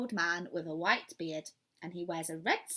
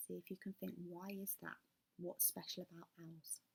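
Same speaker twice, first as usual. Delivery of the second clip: whispered aside; 16 dB quieter, faster than usual. Real, not acted. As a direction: lean in and whisper the question. Reader whispers a question to the child.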